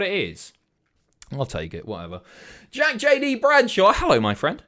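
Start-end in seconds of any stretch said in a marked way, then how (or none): 2.7-4.6 a big booming hammed-up hello; goofy loud greeting. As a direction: bellow the ahoy like a pirate hailing a ship. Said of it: "I don't know what that was".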